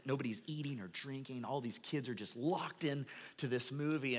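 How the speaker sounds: low, soft storytelling; hushed suspense. Real, not acted. Preacher telling of a silent theater, hushed suspense. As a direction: drop the voice to draw listeners into a scary moment.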